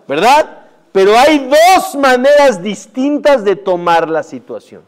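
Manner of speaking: sermón fuerte, lento y más agudo; predicación enfática. Acted. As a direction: predica a la congregación con fuerza. Predica con fuerza. (el rabino predica con fuerza, despacio)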